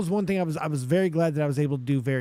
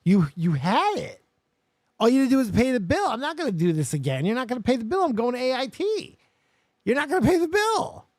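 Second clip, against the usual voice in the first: raised, louder exasperated yelling, climbing higher; angry retort; 5 semitones higher, 3 dB louder, faster than usual. Retold or imitated. Yelling at mother over the unpaid phone bill, furious. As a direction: shout it back in disbelief, voice rising. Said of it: "I'm like".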